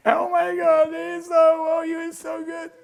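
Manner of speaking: high, loud mock-gushing applause; imitating thrilled fans shouting compliments; gushing fan praise. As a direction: ham up an adoring crowd. Audience applauding him.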